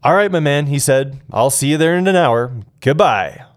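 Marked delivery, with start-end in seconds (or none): 0.0-0.7 louder, cheerful buddy greeting; upbeat friend. Retold or imitated. 1.3-3.4 loud, upbeat radio sign-off; celebratory goodbye. Retold or imitated. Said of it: "like a celebration at the end".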